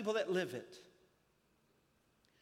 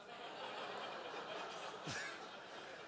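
soft breathless wheeze of laughter; airy silent laugh; 3 semitones higher, 14 dB quieter than usual. Real, not acted. Pastor laughing quietly after the Abflex joke. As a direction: laugh almost without voice.

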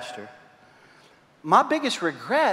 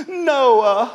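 put-on higher whiny child's voice; upset little girl; 6 semitones higher, 4 dB louder than usual. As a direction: complain like a hurt five-year-old.

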